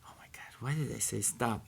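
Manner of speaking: soft unvoiced whisper of regret; regretful whisper. Realizing he stopped the fire, regretful whisper. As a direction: whisper it, wishing you hadn't.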